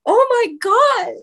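breathless, high oh my God; replaying her jaw dropping in shock; 1 stunned gasp. Guest retelling her reaction to a follower's video, stunned high gasp.

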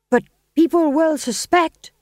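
high, loud stage husband. Acted. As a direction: declaim it like a nervous lord. Winslow in the play.

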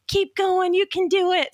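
high, cheering mock encouragement; mock trainer cheering on the last runner; encouraging shout. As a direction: call it out like cheering a straggler. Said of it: "And they're like".

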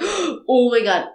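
sharp gasp, then slow "oh my God"; imitated chills-and-watery-eyes reaction; awestruck gasp. Showing a friend how her husband reacted, awestruck. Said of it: "the chills and your eyes get water".

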